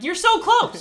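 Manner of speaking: almost shout it at him; exasperated. Retold or imitated. Telling the oil seller he nearly gets it, an exasperated loud cry. Arguing with the show's guy.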